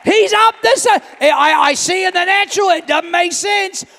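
high, loud shouted preaching; full-force preaching. Real, not acted. Preacher rousing the congregation, high full-force shout. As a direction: shout it to the whole church.

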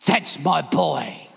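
low, slow, proud; a slow proud sigh of praise; proud and moved. Real, not acted. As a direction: let the pride sink in slowly. Proud preacher after story.

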